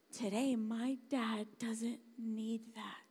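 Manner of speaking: normal talking voice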